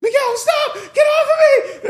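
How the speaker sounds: hammed-up panicked shriek; screaming. Shown as a demonstration. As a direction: scream at Miguel to get off you. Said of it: "I could say something and it can mean".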